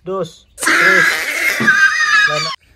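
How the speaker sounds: high laughing shout; excited shout. Real, not acted. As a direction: yell the countdown laughing. (sumisigaw na tumatawa)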